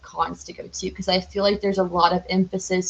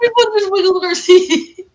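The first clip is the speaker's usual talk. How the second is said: giggling through the words, high; laughing at the shared goof; words breaking into laughter; 11 semitones higher, 6 dB louder than usual. Real, not acted. Both wiggled feet, giggling.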